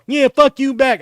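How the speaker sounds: high, loud mimicry of his deaf speech; mocking the deaf coach's voice. Kid mocking the deaf coach to his face, loud high mimicry. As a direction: throw his own voice back at him. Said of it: "I started doing his voice back to him".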